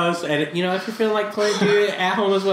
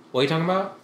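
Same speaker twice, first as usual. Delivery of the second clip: quick, run-together question; said too fast; 3 dB quieter, much faster than usual. Retold or imitated. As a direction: rattle off "What are you talking about?" in one breath. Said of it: "Oh, that was pretty fast".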